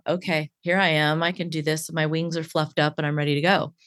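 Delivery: steady yourself after a panic; calm self-reassurance. Acted. Coach talking herself down before a podcast, calm and ready.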